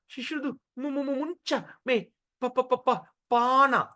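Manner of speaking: repeated "mu" before "muncha", "pa" before "pana"; stuck repeatedly on "mu" and "pa" before "pana"; drunken stammer. Acted. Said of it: "you can't even say the word pana".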